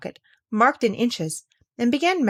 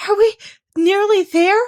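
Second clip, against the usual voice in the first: high, louder, gasped question; panting; 12 semitones higher, 8 dB louder than usual. Acted. Breathless Alice asks the Queen while running. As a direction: squeeze it out between breaths. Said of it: "Alice managed to pant out at last".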